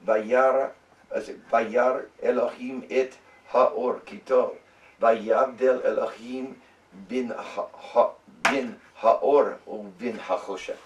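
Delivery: slow, broken Hebrew with pauses between words; slow deliberate Hebrew reading; slow careful reading. Shown as a demonstration. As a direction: take each word slowly syllable by syllable. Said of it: "go slow".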